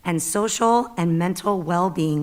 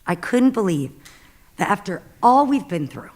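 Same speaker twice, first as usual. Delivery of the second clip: ordinary talk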